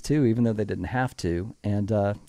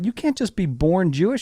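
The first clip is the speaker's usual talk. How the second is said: higher, protesting know-it-all; mock mansplaining; 7 semitones higher than usual. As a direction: playfully set her straight, pitched up. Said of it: "I start mansplaining".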